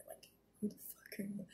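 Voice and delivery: who the fuck are you breathed out as a whisper; whispered disbelief. Real, not acted. Speaking to the camera about the man, whispered disbelief.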